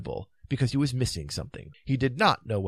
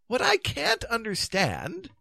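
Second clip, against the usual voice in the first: high, slow, drawn-out complaint; slow, pitched-up plaint; plaintive; 12 semitones higher, slower than usual. A narrator's reading. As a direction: draw it out high in baffled protest. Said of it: "said the king plaintively".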